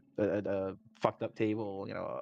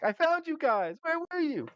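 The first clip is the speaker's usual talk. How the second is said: much higher, cheerful and clueless; high goofy friend voice; 3 dB louder than usual. Retold or imitated. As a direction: play it like a sleepy friend showing up late. Friend reappears from rat form.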